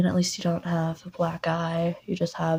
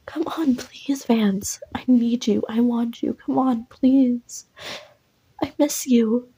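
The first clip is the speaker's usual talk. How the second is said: put-on high, breathy pleading ex-girlfriend voice; pleading girl; 5 semitones higher, slower than usual. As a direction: beg him to take her back, pitched up and whispery.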